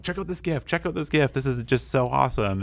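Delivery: high, eager classroom cheer; excited praise. Retold or imitated. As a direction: rave about the kids' work. Teacher showing students' gifs excitedly.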